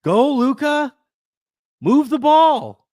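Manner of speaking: loud, high-pitched sports-fan yell. Retold or imitated. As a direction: holler orders at the players on screen. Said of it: "hear myself yelling at the T V".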